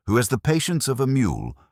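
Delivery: ordinary delivery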